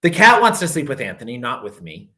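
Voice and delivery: plain reading voice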